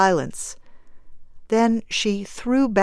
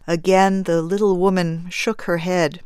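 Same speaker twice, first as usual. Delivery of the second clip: usual speaking voice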